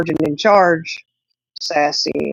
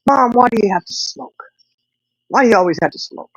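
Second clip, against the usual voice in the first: put-on higher nagging kid voice, lightly done; daughter's nagging; 7 semitones higher than usual. Daughter nagging mom outside Walmart, lightly voiced whine. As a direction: imitate the little girl scolding mom. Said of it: "she says".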